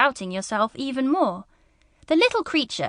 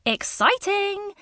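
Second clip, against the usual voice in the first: excited; 9 semitones higher, slower than usual. Acted. Thrilled at trip.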